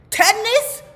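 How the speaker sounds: can't believe what she's hearing; stunned disbelief. Retold or imitated. Narrator repeating the diagnosis again, squeaking with disbelief.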